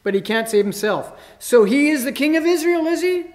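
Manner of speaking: high, loud sarcastic sneer; mock the king title with scorn; sarcastic taunt rising high and loud; sneering scoff. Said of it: "they scoffed".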